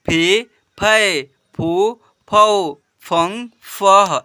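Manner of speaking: slow, drawn-out chant of each syllable; syllable drill. Shown as a demonstration. Teacher reading the chart to children, slow drawn-out recitation. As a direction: recite each letter clearly for pupils to repeat.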